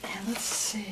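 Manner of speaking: whisper. Real, not acted. Aside while opening mail.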